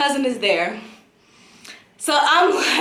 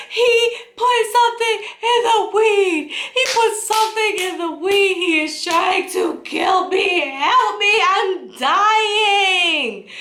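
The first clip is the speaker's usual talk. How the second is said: high, hammed-up hysterical wail; crying, shaking panic; 10 semitones higher than usual. Crying guest begging cousin for help, hammed-up panic. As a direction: draw out the last words in mock-tearful terror. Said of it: "like, crying and I'm".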